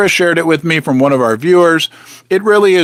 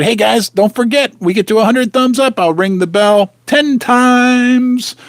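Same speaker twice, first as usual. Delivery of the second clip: normal talking voice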